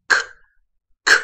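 k as a bare voiceless release, no vowel; just the click of k, twice with a gap; isolated phonics k sound; consonant sound. Shown as a demonstration. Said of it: "C K二合辅音".

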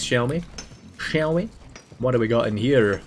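ordinary talk, nothing marked

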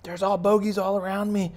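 high, loud pilot in trouble; radio pilot. Pretend. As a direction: call it out over the radio under fire. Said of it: "And it's like".

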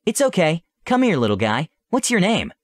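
high, gentle coaxing; coaxing princess. Acted. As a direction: coax the little mushroom closer. Coaxing the mushroom.

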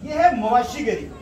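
normal talking voice

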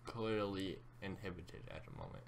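normal talking voice

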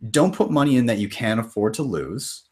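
usual speaking voice

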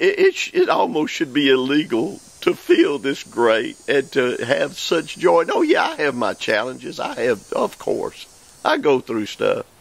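ordinary talk, nothing marked

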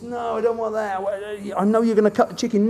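a hint of a wary opponent; voicing the opponent. Coach voices the wary opponent's thoughts, lightly voiced. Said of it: "He thinks".

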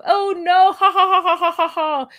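high cackling mock dismay; laughing friend. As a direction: laugh it out in disbelief. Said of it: "The other friend is".

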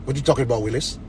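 low, softened catchphrase delivery; catchphrase imitation. Doubting men who show off New York girls, low catchphrase imitation. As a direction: drop the pitch for a muted quoted comeback. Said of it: "I'm, and I'm like".

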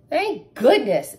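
slow, loud relieved breath; relieved sigh. Acted. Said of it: "sighed in relief".

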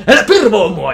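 loud, high outburst with a laugh; angry snap. Retold or imitated. Teen lashing out at the hugging mascot, loud and high. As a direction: lash out at the mascot.

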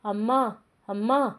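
a touch of crying, narrated; understated tearfulness. A narrator's reading. Daughter missing her mother calls for her, mildly tearful. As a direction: call out with a mild, acted tearfulness. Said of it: "ఏడుస్తూ అంది అంజలి".

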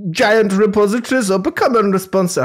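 mock German accent falling apart; slipping German accent. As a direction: try a German accent and lose it. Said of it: "I've lost the accent".

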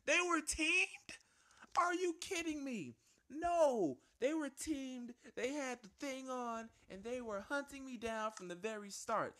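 very high, loud indignant shock; shrill outrage that slowly calms down; outraged disbelief. Real, not acted. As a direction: cry foul at the unfairness. Discovers opponents teamed up.

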